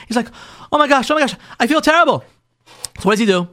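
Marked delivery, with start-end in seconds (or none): 0.7-2.3 high, retold panic of guilt; acted-out dismayed gushing; horrified apology. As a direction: imitate a husband suddenly mortified. Husband horrified at his mistake.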